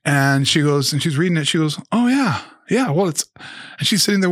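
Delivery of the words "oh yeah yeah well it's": higher, hesitant mom voice; a light imitation of mom hedging; voicing his mom. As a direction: play the unsure mother caught off guard. Son voicing his mom in story.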